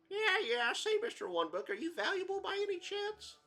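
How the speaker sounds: very high, loud squawky character voice; brash cartoon sidekick.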